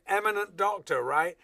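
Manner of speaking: loud, high, pointed jab; mock pointed remark. Actor points out the eminent doctor to Stanley, loud and mocking. Acted incredulous jab at director.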